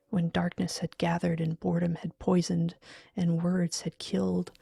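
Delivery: ordinary delivery, nothing marked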